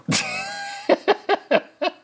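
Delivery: high-pitched breathy giggle; high laugh. Real, not acted. Vlogger cracking up at his bit, high giggle. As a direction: burst out in a squeaky laugh.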